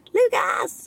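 high-pitched call of a name. Shown as a demonstration. Parent calling her child from the audience as he passes, calling out. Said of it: "så ropar jag så här".

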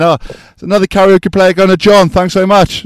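high, loud, salesy announcement; excited TV host. Retold or imitated. Shopping channel host announcing a sale to viewers, high and loud. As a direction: sell it like a bubbly shopping host.

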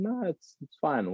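hint of a small boy answering, high and quiet; boy's voice.

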